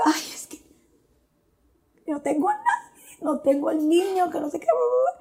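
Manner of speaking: high, whimpering sobbed words; imitating her own crying. Mother at the hospital cries to her husband, in a high, whimpering wail. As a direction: play it as a tearful wail over the lost baby. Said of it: "empecé a llorar".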